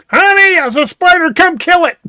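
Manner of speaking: mock yell in a high-pitched wife voice. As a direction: imitate a wife calling out for rescue, way up high. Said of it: "Annette will yell for me".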